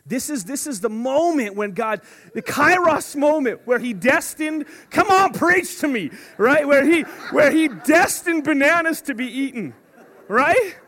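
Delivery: mock fervent preaching. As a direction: preach it like a revival, tongue in cheek. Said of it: "I'm getting emotional".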